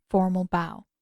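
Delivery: ordinary delivery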